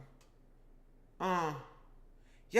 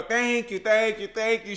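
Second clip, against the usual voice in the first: emphatic repeated thanks, louder; 6 dB louder than usual. Pretend. Cook thanks listeners after remembering the verse, loudly. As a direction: project each thank you.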